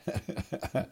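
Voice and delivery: a light, airy laugh; quiet amused chuckle; soft breathy chuckle. Acted. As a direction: chuckle gently at the boy. Laugh after the Jesus quote.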